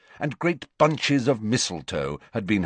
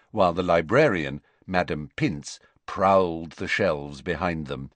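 plain reading voice

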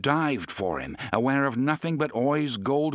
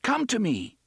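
encouraging; 11 semitones higher than usual. A narrator's reading. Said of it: "Jake encouraged".

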